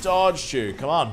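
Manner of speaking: high frustrated yelp; frustrated outburst. Real, not acted. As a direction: complain sharply at the screen. Frustrated at the game.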